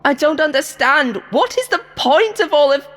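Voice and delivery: high, loud indignant protest; indignant cry cut off mid-word; indignant outburst. Acted. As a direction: demand answers, outraged and scared. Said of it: "Number four's indignation".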